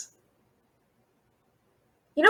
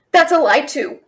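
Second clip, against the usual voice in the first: blurted confession; 7 dB louder than usual. Acted. Confesses loudly to dragon.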